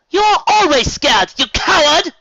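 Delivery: very high, loud, slow shout; furious yell. Acted. Rarity yelling at Fluttershy, loud high shout. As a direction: yell right in her face. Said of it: "Rarity yelled".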